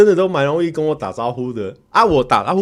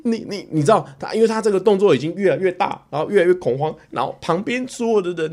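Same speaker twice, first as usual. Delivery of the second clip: ordinary talk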